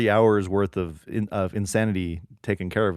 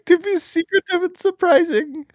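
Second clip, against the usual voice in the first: high silly voice; 4 dB louder than usual. Pretend. Joking along in a high voice.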